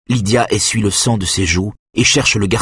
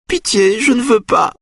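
very high, desperate begging; supplication. A narrator's reading. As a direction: beg for mercy, pitch way up. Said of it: "implore-t-elle". (supplication désespérée, très aiguë)

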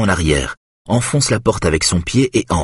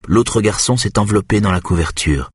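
ordinary delivery

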